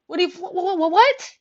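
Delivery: high-pitched, sputtering disbelief; hammed-up speechless astonishment; stammering shock. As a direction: stutter up to an incredulous "what".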